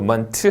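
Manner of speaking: "ment" as 먼트; extra final vowel. Shown as a demonstration. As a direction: add 으 after the T of "ment". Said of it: "하셔도 안 됩니다". ("ment"를 '먼트'로 잘못 발음)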